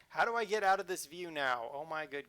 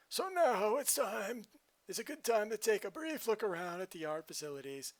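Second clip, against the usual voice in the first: announcer voice; 5 semitones higher than usual. Acted. Reading game announcer text.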